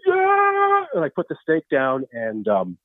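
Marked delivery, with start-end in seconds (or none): 0.1-0.9 high, drawn-out Rusty holler; yelled answer. Acted. Rusty answering Tyler about the steak, high holler.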